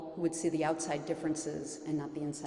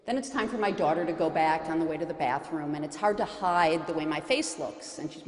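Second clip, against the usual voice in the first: ordinary talk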